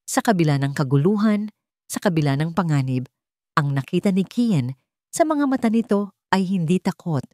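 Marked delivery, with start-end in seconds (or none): none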